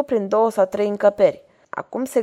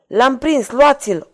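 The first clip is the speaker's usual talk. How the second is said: loud, raised order to seize him; commanding order; 5 semitones higher, 6 dB louder, slower than usual. A narrator's reading. Lucreția to her guards at the chase's end, commanding. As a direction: order your men forward, building on the last word. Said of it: "ordonă ea".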